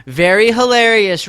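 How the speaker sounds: sarcastic jab at a chatter's joke; loud sarcastic. Pretend. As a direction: throw the compliment back with heavy irony. Sarcastic reply to Rev's joke.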